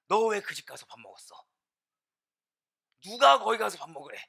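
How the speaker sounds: loud reprimand from a church elder; acted scolding. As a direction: tell off a member, hitting the first words hard. Acts church elder scolding member.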